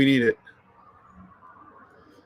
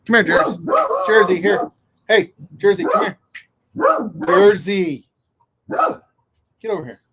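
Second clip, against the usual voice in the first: calling the dog; 5 semitones higher than usual. Real, not acted. Owner calling his basset hound Jersey, raised call. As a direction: call the dog to come over.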